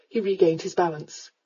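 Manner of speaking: ordinary delivery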